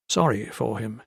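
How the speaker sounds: usual speaking voice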